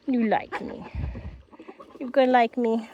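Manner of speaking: ordinary talk, nothing marked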